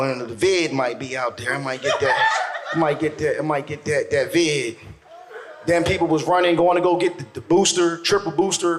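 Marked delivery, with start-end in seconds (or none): 0.0-4.8 mock fearful mumble. Scared person fearing covid outside.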